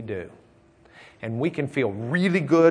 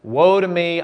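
louder, higher cry of woe; wailing cry of woe; 6 semitones higher, 8 dB louder than usual. Retold or imitated. Preacher voicing Isaiah crying woe before God, louder wailing. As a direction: cry out as if ruined. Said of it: "That's a wailing, crying out".

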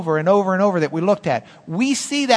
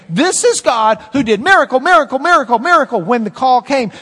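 mounting excitement; 5 semitones higher, 5 dB louder than usual. Real, not acted. Preacher building on miracles.

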